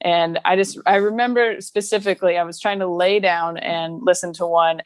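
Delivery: normal talking voice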